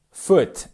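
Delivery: "foot" with a short lax u; correct English FOOT. Shown as a demonstration. Teacher modelling the word, "foot" with a short English vowel. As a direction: keep "foot" short and relaxed. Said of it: "le pied se dit".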